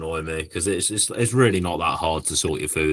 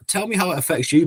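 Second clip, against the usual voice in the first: challenging retort; 9 semitones higher than usual. Retold or imitated. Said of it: "I'll just turn around and go".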